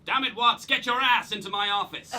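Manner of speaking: hammed-up angry American boss; boss shouting. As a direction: shout across the room like an overbearing boss. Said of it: "my boss shouted at me from across the room".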